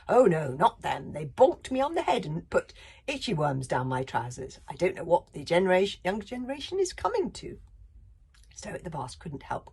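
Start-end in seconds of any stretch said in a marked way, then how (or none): 0.1-7.6 mock outraged Bertha.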